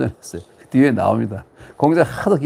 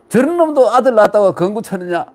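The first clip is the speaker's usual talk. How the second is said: scornful jibe; 7 semitones higher, 4 dB louder than usual. Acted. Scornful dialect saying quoted.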